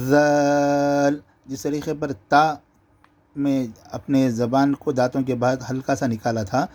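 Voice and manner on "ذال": ذال opening on a light voiced th, vowel stretched long; tongue past the teeth, draw out ذال; light lisped ذال. Shown as a demonstration. Teacher demonstrating the letter, ذال lightly buzzed and stretched. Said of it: "ہلکی سی آواز نکالیں گے".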